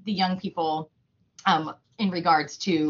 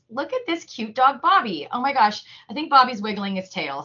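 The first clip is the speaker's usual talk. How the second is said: higher-pitched play-acted delight; role-play gushing; 4 semitones higher than usual. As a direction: gush over a cute dog for a young audience. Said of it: "do a little, like, role play".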